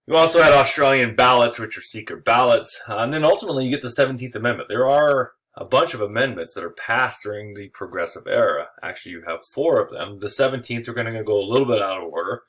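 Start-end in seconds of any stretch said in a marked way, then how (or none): none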